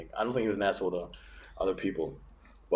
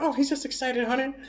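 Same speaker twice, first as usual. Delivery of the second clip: high, softer grandma voice; imitated grandma; 12 semitones higher, 5 dB quieter than usual. Grandma explains grandpa's temper away, soft high imitation. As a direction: placate in a high little voice. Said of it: "my grandma would be like".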